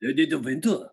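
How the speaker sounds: put-on half-hearted French accent. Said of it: "can't do it in French".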